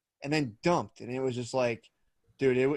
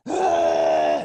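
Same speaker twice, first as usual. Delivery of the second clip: imitated baby cry; 8 semitones lower, 10 dB louder than usual. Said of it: "she'll sit up and just be like".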